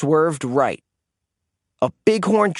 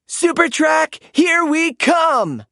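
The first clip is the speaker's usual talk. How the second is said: much higher, slow, joyful whoop; shouted; 11 semitones higher, slower than usual. A narrator's reading. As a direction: cheer it out before the big run. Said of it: "shouted Darrington".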